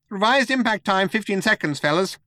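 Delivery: high-pitched computer announcement; perky shipboard computer; chirpy computer. A narrator's reading. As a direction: break off singing to announce it perkily. Computer breaks off song to announce.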